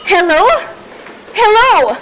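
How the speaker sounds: loud, repeated hellos into a dead line; calling into phone. Acted. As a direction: shout down the phone, getting frantic.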